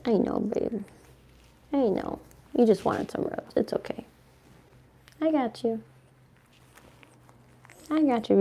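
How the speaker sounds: soft, gentle reassurance; soothing the dog. Real, not acted. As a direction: comfort the dog quietly. Comforting her dog.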